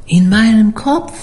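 put-on high, eerie old cook; mysterious cook's voice. A narrator's reading. Koch erklärt sein Sehen. (hoher, geheimnisvoller Schiffskoch)

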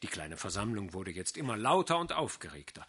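ordinary delivery, nothing marked

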